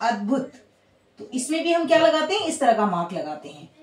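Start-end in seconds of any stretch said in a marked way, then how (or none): 0.1-0.5 a gentle marvelling अद्भुत; mock amazement. Shown as a demonstration. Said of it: "एक होता है अद्भुत".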